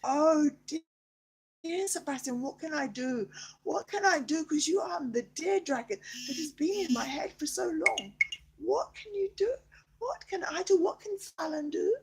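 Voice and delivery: high, anxious pleading; distressed plea. Pretend. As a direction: beg to know how to help.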